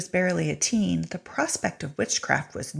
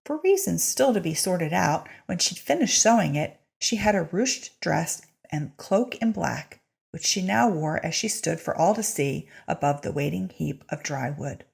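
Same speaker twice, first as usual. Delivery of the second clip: ordinary delivery, nothing marked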